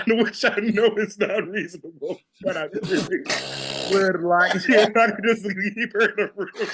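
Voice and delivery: high, loud, laughing admission. Real, not acted. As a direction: talk through real laughter, cracking up at yourself. Laughs at own unreasonable wish.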